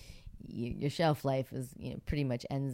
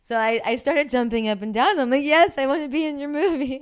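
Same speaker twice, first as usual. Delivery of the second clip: excited; 12 semitones higher, 11 dB louder than usual. Retold or imitated. Teen thrilled at casting.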